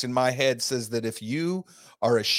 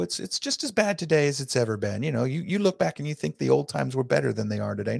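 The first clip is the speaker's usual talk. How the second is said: normal talking voice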